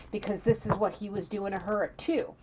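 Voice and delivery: normal talking voice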